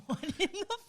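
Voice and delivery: retold stunned reaction, through a laugh; mock bewilderment, breaking into a laugh; baffled disbelief. Wife reacting to the gift reveal, baffled.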